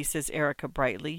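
ordinary delivery

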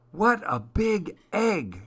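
high, slow, wondering elf voice; christmas elf. Acted. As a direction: marvel at it like a tiny elf. Elf's thought voiced.

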